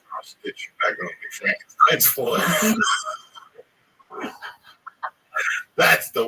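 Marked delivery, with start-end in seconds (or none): 2.4-3.6 a sudden, high burst of laughter; big laugh. Real, not acted. Host cracking up at his own bit, big laugh. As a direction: laugh out loud at the joke.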